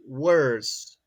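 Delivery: worse said slowly and clearly; correct form. Shown as a demonstration. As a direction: stretch worse out as a model word. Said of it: "We say".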